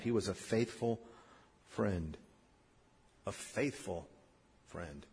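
very soft and low, pausing before friend; hushed sincerity. Real, not acted. Preacher honouring the man who cared, hushed and sincere. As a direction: sink almost to a hush. Heartfelt close of the story.

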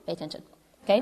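softly voiced, firm parent; quiet, deliberate telling-off; parent instructing kids. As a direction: lightly act a parent warning children. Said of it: "like you look at your kids".

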